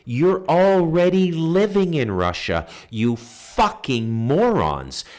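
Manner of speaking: real heated outburst, voice way up and loud; furious rant. Real, not acted. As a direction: blow up at them for real. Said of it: "I'm getting heated up".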